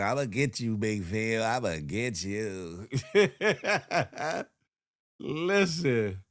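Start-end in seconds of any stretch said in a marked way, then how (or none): 0.0-2.9 a sly promise; low teasing threat; mock threat. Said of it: "She probably like".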